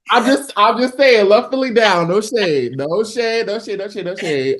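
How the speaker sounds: high, defensive rush of reassurance; playful backpedaling. Real, not acted. Artist placating a host from Philly, playful backpedaling. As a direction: backpedal fast and high, insisting no offense.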